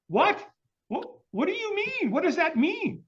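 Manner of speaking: very high, squeaky disbelief; bewildered. Retold or imitated. Confused man reacting to a stranger's cryptic advice, bewildered. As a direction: stammer it out, voice leaping up in confusion.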